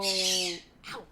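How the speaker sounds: sudden pain in the knee; wincing through knee pain. Real, not acted. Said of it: "It does hurt".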